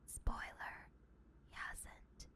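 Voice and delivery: whispered spoiler, very soft; conspiratorial near-silent whisper; hushed mocking aside. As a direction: drop to a whisper to gloat. Mocking aside about rival's failed attempt.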